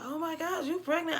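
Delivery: high, amazed squeal; a hammed-up gasp of surprise; mock surprised friends. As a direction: imitate friends shocked by the news. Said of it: "They're like".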